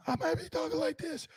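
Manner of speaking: strained, cracking half-voice; losing his voice. Real, not acted. Hoarse host promises every post-game show, voice giving out. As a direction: squeeze words through a shot voice. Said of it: "my voice might not make it".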